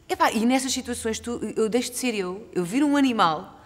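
ordinary talk, nothing marked

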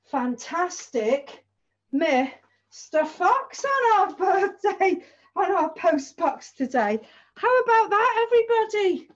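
excited announcement. Acted. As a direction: announce it big to the kids. Big announcement to children.

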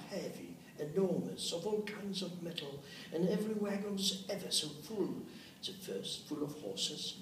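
usual speaking voice

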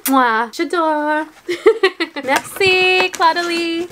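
bubbly French with a giggle. Pretend. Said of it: "Feels weird being bubbly in French".

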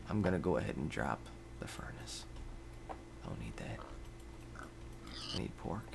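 low whispered mutter; hushed grumble; whispered aside. Real, not acted. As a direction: talk to yourself under breath.

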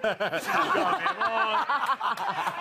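a long embarrassed laugh; embarrassed laughter at his own mistake. Real, not acted. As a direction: laugh it off, falling away.

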